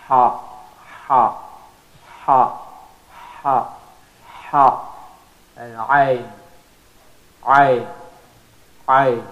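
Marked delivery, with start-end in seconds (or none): 0.1-5.0 ha breathed hard from the middle of the throat; throaty ha. Shown as a demonstration. Teacher drilling the Arabic ha for students. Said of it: "middle of the throat is ha". 7.4-9.2 ayn squeezed from the middle of the throat; throaty ayn. Shown as a demonstration. Teacher drilling the ayn sound. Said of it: "From the middle of the throat".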